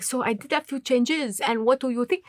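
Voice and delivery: put-on casual French-accented English. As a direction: imitate a Frenchman casually asking. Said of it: "I speak a little bit of French".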